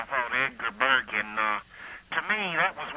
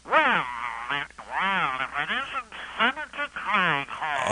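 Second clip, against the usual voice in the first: Fred Allen impression; 4 semitones higher than usual. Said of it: "imitate Fred Allen by holding your nose".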